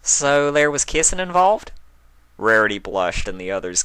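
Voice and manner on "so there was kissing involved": teasing smile. A narrator's reading. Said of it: "Applejack smiled".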